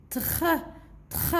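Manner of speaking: "tr" as a short blend with throaty R; blended consonants. Shown as a demonstration. Teacher repeats for the children, blended consonants. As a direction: sound out "tr", not the letter names. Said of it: "il faut juste savoir comment prononcer".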